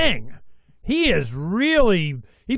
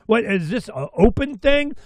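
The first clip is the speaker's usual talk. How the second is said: ordinary talk, nothing marked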